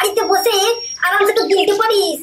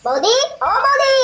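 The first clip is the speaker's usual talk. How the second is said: calling out; 4 semitones higher than usual. Acted. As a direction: call for someone at the door. Calling out to sister-in-law.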